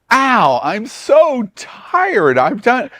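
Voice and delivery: high, slow, drawn-out whine of exhaustion; mock whiny tired passenger. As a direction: ham up a tired cruiser's complaint. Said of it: "If one person says to me".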